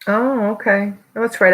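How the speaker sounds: ordinary delivery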